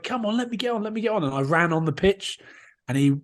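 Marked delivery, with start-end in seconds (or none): 0.0-1.2 high, softened mock pleading; retold pleading. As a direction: beg to be let on, pitched up. Pleading with the touch judge.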